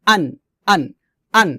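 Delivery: short "আন" with plain onset; separate, unpressed "আন"; normal hamza. Shown as a demonstration. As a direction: say "আন" with an easy glottal start. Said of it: "normal করে উচ্চারণ করব".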